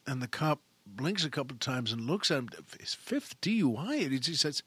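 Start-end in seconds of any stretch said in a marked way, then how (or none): none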